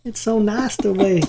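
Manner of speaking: dreamy, slow, high country drawl; country accent. Pretend. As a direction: swoon it in a sweet country voice. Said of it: "It's so cute with your little country accent there".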